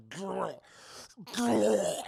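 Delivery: hammed-up beastly grr; monster growl. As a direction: growl like a mutated man. Imitating Charlie's monstrous noise.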